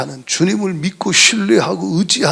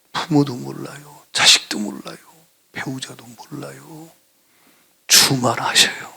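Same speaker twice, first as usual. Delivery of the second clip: tender preaching; 5 semitones lower than usual. Acted. Intimate softer sermon section.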